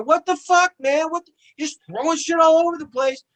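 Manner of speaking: high, loud yelling "what the fuck"; mock screaming. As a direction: play the friends screaming at him. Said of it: "They're screaming in my ear".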